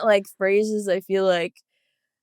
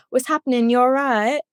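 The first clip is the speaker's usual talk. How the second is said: put-on a light British accent, higher and bright-eyed; good British accent; 5 semitones higher than usual. To her podcast host, guest tries a light British accent. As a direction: try out a cheerful London greeting in British English. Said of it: "I'll try to do a good British accent".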